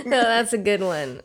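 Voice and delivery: ordinary delivery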